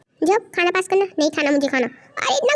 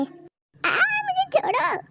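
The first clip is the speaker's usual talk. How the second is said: soft gasp then high plea; scared plea; 12 semitones higher, 5 dB quieter, much slower than usual. Acted. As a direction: beg breathlessly to be freed. Captive begs to be released. (डरकर गिड़गिड़ाते हुए)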